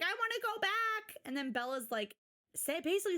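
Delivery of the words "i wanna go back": high whiny plea; whiny mother. Retold or imitated. Mother whining to daughter, high whiny plea. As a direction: plead like a mother who wants to go home. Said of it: "Samantha's like".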